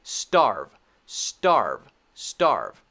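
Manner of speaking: "Starve" low and falling, voiced through the V; continuous voicing. Shown as a demonstration. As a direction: keep the voice going to the end of "Starve". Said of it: "continue the voice during this one".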